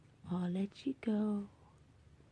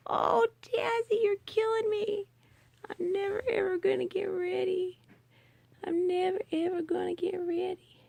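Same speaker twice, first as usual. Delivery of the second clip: cooing squeal; 7 semitones higher, 6 dB louder than usual. Real, not acted. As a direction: gush like the cuteness is too much. Squealing at cute cat.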